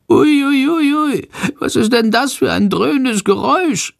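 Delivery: very high, squeaky anxious voice; panicking at the engine roar; frightened little bat. A narrator's reading. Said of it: "fragt er ängstlich".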